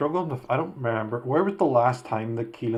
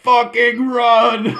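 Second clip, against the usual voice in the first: frantic high yell, dragged out slowly; screaming; 12 semitones higher, 11 dB louder, much slower than usual. Retold or imitated. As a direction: scream it like running for your life. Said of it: "they just start screaming".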